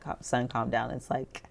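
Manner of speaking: soft, low, dropping into whisper; hushed calming. Acted. Dad calming son super early in morning, hushed. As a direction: hush an excited son down.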